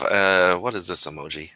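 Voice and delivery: usual speaking voice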